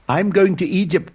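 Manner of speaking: high, loud eager call; excited cry. A narrator's reading. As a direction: call it out to anyone listening. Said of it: "cried the swallow".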